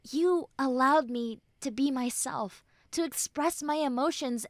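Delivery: normal talking voice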